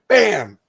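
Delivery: a single gavel slam, loud; loud bam sound. Retold or imitated.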